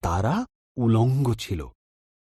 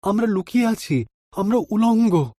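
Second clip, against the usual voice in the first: frightened Adam; 8 semitones higher than usual. A narrator's reading. Adam confessing to God he is hiding, frightened and fast. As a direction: blurt out the shameful confession. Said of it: "আদম উত্তর দিল".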